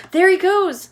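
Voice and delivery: high, loud shout. Acted. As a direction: point and shout. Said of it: "somebody shouted".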